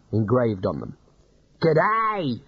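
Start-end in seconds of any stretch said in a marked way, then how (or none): 1.6-2.4 high, bright outback bandit hailing; mock friendly bandit greeting; cheerful shout. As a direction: greet him like a grinning bandit. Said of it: "he shouted cheerfully".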